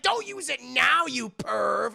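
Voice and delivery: high, loud indignant yell; mock outrage. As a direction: scold a peeper at a shout. Outraged girl scolds peeping boy.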